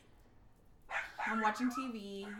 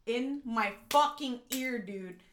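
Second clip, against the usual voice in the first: ordinary talk, nothing marked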